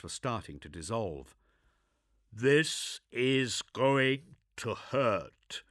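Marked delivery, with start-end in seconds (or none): 2.3-5.6 slow, whispered, every syllable spelled out; dry gruff warning, each syllable crisp; dry, over-enunciated. Acted. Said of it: "voice was dry, and he over-enunciated each syllable".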